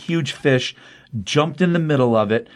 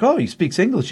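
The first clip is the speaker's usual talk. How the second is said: retold amazement in a higher pitch; mock surprise; 8 semitones higher than usual. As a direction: imitate a surprised turtle, voice lifting high.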